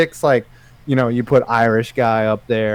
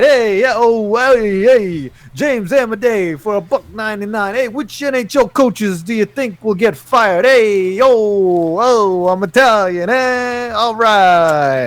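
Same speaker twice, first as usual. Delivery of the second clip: much higher, hammed-up mock Italian accent; bad Italian voice; 7 semitones higher than usual. Spun the wheel, reading the tweet as a bad Italian. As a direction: play a cartoonish Italian guy, heys and ehs. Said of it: "Italian, all right".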